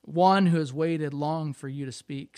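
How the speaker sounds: ordinary delivery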